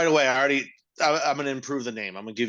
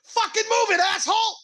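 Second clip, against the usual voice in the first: high, loud screaming siren voice; mock furious fire truck yelling; aggressive siren; 5 dB louder than usual. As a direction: shriek at traffic like an angry siren.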